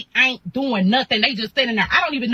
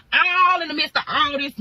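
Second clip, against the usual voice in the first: usual speaking voice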